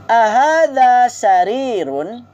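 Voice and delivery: slow, drawn-out Arabic drill phrase; slow model for repeating. Shown as a demonstration. Teacher models the phrase slowly for students to repeat. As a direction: spell out each syllable for the class to echo. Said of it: "drill model for repeating".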